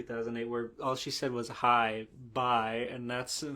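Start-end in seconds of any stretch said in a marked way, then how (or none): none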